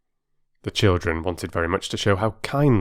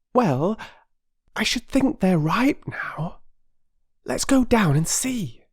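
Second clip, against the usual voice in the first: put-on high, slow, hushed girl's voice; a girl plotting in secret; secretive little girl; 14 semitones higher than usual. Phyllis whispering her plan in the backyard, hushed and high.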